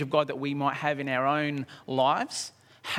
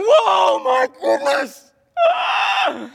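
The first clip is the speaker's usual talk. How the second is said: high, loud mock-panicked shout; hammed-up freak-out; 10 dB louder, much slower than usual. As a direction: shout and scream in comic terror. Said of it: "freaking out".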